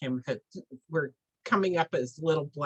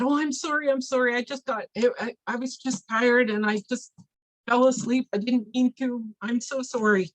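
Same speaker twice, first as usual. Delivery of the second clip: higher-pitched, stammering excuses; flustered apology; 7 semitones higher, 3 dB louder than usual. Acted. Helper caught sleeping apologizes to medicine man, flustered. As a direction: plead as a scolded helper.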